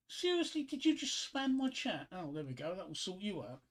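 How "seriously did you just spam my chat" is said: incredulous. Real, not acted. Streamer to viewer spamming his chat, incredulous. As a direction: squeak it out in affronted amazement.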